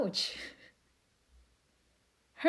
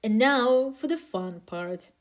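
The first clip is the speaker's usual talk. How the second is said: ordinary talk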